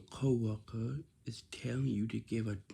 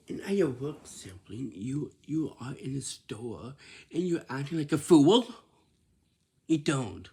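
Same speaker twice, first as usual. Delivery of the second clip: usual speaking voice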